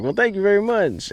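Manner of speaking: high flattered thank-you; gushing thanks. Retold or imitated. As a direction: float it up sweetly, pleased. Thanking the landlord again.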